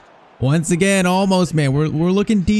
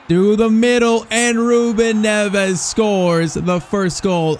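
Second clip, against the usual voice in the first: excited goal call; 6 semitones higher, much slower than usual. Real, not acted. Scoring in the final.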